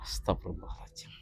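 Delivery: breathy whispered astagfirullah; whispered prayer. Acted. Ustaz whispering istighfar after the dukun's insult. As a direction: hush it under your breath.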